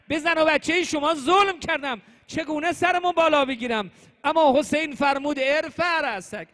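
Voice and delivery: loud, high-pitched devotional retelling; pleading, fervent sermon storytelling; impassioned preaching. Acted. Impassioned retelling of repentance scene.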